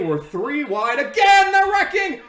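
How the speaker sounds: very high, very loud frantic screams; panicked screaming. Real, not acted. Fan screaming at favourite driver Corbin before the crash, panicked. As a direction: scream a warning that can't be heard.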